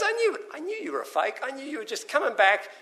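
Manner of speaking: high-pitched mock indignation; accusing brother. Acted brother accusing the prodigal son.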